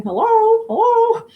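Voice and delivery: imitating a parrot's hello. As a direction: chirp it like the parrot calling you awake. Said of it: "I'm gonna hear the parrot saying".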